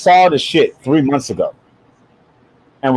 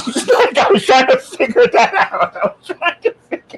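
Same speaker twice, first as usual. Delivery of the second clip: higher pitch, words breaking into laughter; laughing while talking; 8 semitones higher than usual. Real, not acted. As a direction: crack up helplessly through the words. Cracking up at own joke.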